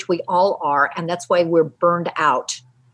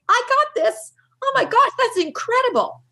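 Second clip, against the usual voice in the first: much higher, put-on upbeat voice; upbeat self-talk; 14 semitones higher than usual. Grown-up kid repeating the learned statement, acted high and upbeat. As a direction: chirp it high, like a proud kid.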